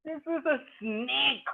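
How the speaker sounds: slow high this is a snake; drawn-out child line. Acted. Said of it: "Chippu says".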